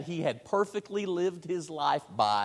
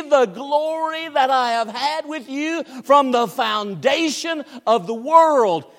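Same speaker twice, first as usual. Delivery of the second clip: confident prayer voiced aloud; 8 semitones higher, 8 dB louder than usual. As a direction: claim it boldly as the Son. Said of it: "He speaks with great confidence".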